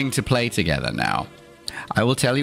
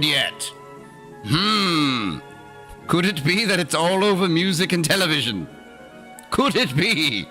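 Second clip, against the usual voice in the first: sarcastic mock; 8 semitones higher than usual. Sarcastic mock-naive rant about word.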